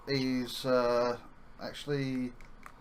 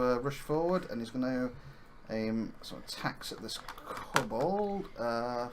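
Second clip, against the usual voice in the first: normal talking voice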